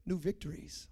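soft, low reveal; hushed emphasis. Real, not acted. Preacher asking congregation to listen to this, hushed emphasis. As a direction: drop right down to announce NEW VICTORIES quietly.

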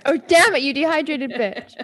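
loud, high sassy yell; sassy shout. Acted. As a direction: yell it with sass.

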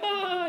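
high, exaggerated happy-cry "ah"; mock joyous wail. Preacher imitating a crier at a wedding, mock joyous wail. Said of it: "you, like, snorted like a pig".

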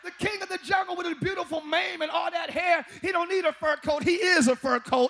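loud, rousing preacher; preaching at full force. Acted. Preacher tells the congregation they are the total package, loud rousing preaching. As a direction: preach it to the rafters.

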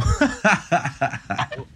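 long burst of amused laughter; real laugh. Real, not acted. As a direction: laugh heartily, genuinely tickled. Amused by guest's reply.

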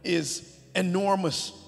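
loud, high, slow preaching of the verses; emphatic scripture reading. Acted. Pastor reading King David's speech, loud and slow. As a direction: proclaim it like it ends in an exclamation point. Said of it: "I gotta say it with that, that emphasis".

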